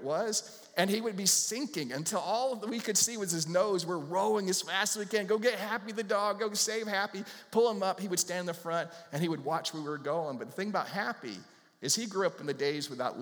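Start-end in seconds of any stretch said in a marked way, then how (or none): 5.3-8.0 higher, urgent pleading to save the dog; urgent cry. Retold or imitated. Family in the boat urging rescue, urgent and pitched up. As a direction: hurry them to save the drowning dog.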